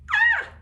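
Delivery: very high, loud scream of fright; frightened scream. Acted. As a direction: shriek as the giant snake appears. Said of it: "They screamed".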